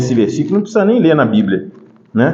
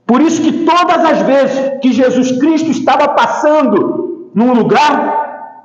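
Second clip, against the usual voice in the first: higher, ringing sermon voice with stretched key words; emphatic preaching; 8 semitones higher, slower than usual. Acted. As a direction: drive the point home to the congregation. Preaches with raised pitch.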